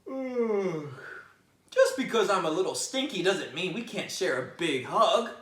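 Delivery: just-woken bear asking for a hug; sleepy bear. Acted. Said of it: "said Bear with a yawn".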